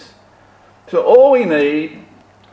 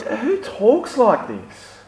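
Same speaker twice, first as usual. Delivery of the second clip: high, drawn-out disbelieving question; puzzled, stretched-out disbelief; incredulous; 6 semitones higher, slower than usual. Acted. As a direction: ask it as if baffled by the claim. Baffled reaction to Jesus's claims.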